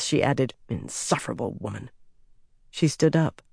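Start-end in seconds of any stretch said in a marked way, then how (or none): 0.7-1.9 muttered low under her breath. A narrator's reading. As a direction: grumble it almost inaudibly. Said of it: "Nearly under her breath".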